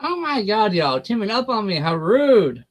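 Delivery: starts high, falls in playful indignation; mock offended. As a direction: play up being ganged up on. Playfully protests being teased.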